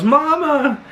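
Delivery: high-pitched imitated cry of "Mama!"; mock child's yell. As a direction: call out high like the twin yelling for mama. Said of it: "runs out and yells".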